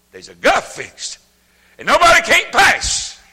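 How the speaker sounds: slow, high, loud preacher's cry; preaching at full force. Acted. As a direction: stretch each word out and ring it to the back pew. Preaching climax.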